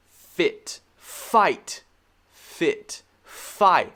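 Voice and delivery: "Fit" with short "ih", "fight" with wide "eye"; short versus long I. Shown as a demonstration. Teacher contrasting two vowels for learners, careful "Fit, fight". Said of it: "The long I sound is I".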